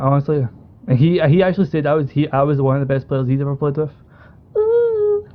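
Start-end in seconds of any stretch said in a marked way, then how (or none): 4.6-5.3 a much higher mock ooh, drawn out in jest; mocking high-pitched ooh; teasing ooh. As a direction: tease him with a high "ooh". Teasing a boast.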